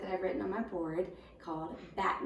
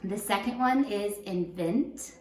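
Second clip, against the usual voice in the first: The second one is invent read slowly; slow, careful dictation read; 4 dB louder, slower than usual. Shown as a demonstration. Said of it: "dictation paper".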